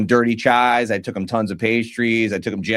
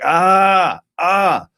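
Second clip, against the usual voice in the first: pained cry; 8 semitones higher, 5 dB louder than usual. Retold or imitated. Wife in pain during treatment.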